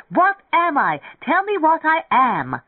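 high, loud, frantic demand; frantic shout. A narrator's reading. Lemming pants and demands they look at him, shouting. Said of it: "Lemming shouted".

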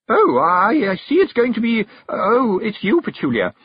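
high, loud, halting hostess; slightly disappointed. A narrator's reading. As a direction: falter brightly through the letdown. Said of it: "slightly disappointed".

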